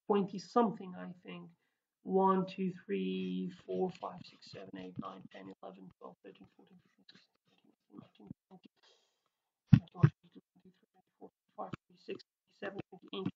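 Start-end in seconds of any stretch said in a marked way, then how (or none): 2.0-13.3 barely audible count, half whispered; very soft private tallying; counting under breath. Real, not acted. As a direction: count quickly to yourself, almost without voice. Counts letters to himself.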